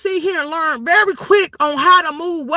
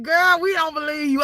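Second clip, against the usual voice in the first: ordinary talk, nothing marked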